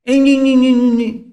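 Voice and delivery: high loud teasing ing ing; nasal childish taunt noises; mocking whine. Lawyer taunting the host with whines.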